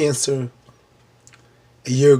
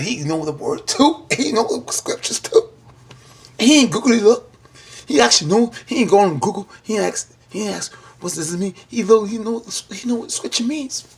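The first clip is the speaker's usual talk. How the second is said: excited; 8 semitones higher, 3 dB louder than usual. Real, not acted. Raving about his scripture knowledge.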